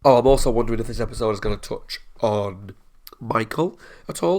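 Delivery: normal talking voice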